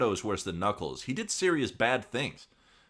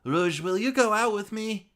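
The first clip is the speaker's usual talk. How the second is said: high nervous voice; 13 semitones higher, 6 dB louder than usual. Acted. Espio asking out.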